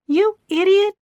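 high, loud furious yell. A narrator's reading. Gina yells at Keith after slapping him, furious. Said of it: "she yelled".

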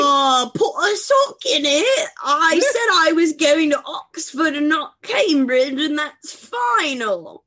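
put-on haughty rich kid putting mum in her place; posh teen to mother. Posh student snapping at mother.

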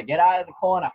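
put-on light Boston accent, corner shout. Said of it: "the Boston accent there".

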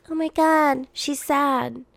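high, mock-shocked gossip voice; a sarcastic tabloid gasp. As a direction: parody a gushing headline. Mocking the tabloid tone.

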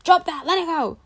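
high, snapped order to drop it; mock stern order; sharp command. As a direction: bark it like scolding a child. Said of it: "it's like".

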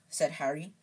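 ordinary delivery

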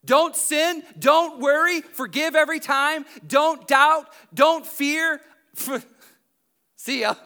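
loud, exasperated quitting voice; hammed-up grumbling walkout; mock fed-up disciples. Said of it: "They're like".

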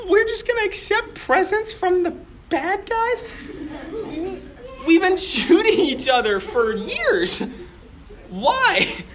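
a touch of outraged disbelief; incredulous. Retold or imitated. Imagined soldier objects to truce.

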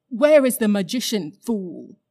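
loud, cold command, fool spat out; imperious demand. Acted. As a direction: demand it like a queen to a servant. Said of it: "said Jadis".

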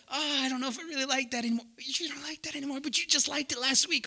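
hint of a wife changing her mind; fickle wife. Acting wife and husband exchange.

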